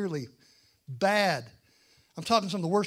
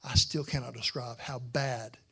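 grave hush; 5 semitones lower, slower than usual. Real, not acted. Solemn warning about hell.

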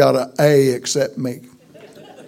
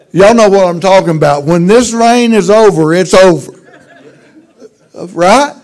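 louder and fast, laughing, hammer it home to the congregation; preacher shouting the joke's payoff; loud emphatic punchline; 6 semitones higher, 9 dB louder than usual. Real, not acted. Preacher driving home the rain joke.

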